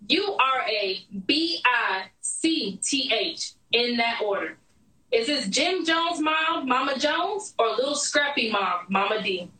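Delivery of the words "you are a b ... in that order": slow, deliberate letter-by-letter spelling; quoted misspelled insult. Retold or imitated. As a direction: spell out B I C T H one letter at a time, with pauses. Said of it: "this bitch spelled the word wrong".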